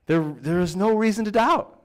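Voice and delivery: usual speaking voice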